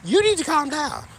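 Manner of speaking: high, loud exasperated order; telling her off. Retold or imitated. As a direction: tell the neighbor to calm down, fed up. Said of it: "I'm like".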